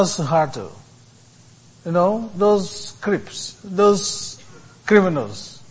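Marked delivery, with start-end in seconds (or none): none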